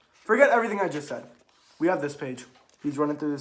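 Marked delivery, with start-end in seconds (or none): 0.1-1.3 flustered reset. Real, not acted. Correcting himself after the mistake.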